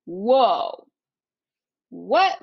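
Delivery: imitating a shocked boyfriend; mock surprise. Boyfriend reacting to her quitting, mock surprise. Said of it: "My boyfriend was like".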